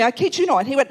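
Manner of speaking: ordinary talk, nothing marked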